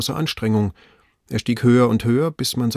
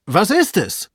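high, eager question; raised, impatient call; highly excited; 10 semitones higher, 3 dB louder, slower than usual. A narrator's reading. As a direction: demand the answer, thrilled. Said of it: "schrie Legrand höchst begeistert".

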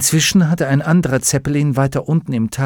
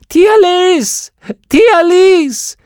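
shrill piping cry, much higher and louder; high squeaky tiny woman; 9 dB louder, much slower than usual. A narrator's reading. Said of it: "die ungewöhnlich helle und piepsige Stimme einer Frau".

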